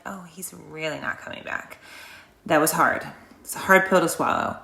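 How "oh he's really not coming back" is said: near-whisper, much softer; hushed realization. Retold or imitated. Wife retelling realizing her husband is never coming back, hushed. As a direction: whisper it to yourself as it sinks in.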